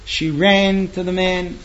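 ordinary delivery

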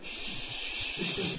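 long, soft shush. Retold or imitated. Husband to his laughing wife, pointed shush. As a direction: hush her with one long breath.

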